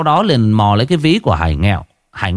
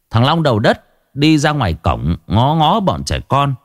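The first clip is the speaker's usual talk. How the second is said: ordinary delivery, nothing marked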